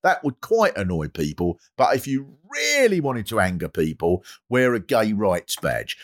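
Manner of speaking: ordinary talk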